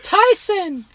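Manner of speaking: put-on high girly voice, repeated name; sing-song girl calling Tyson; man doing a woman's voice. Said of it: "I'll do my best girl impression".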